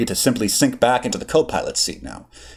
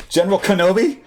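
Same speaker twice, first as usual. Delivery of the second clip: excited; 10 semitones higher than usual. Acted. Delighted recognition, grinning.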